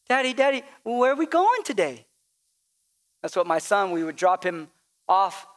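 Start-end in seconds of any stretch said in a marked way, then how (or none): 0.1-2.1 a hint of a child, a bit higher; child's question. Father imitates his son in the car, lightly higher. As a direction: lightly play a kid asking. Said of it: "your children say something like that".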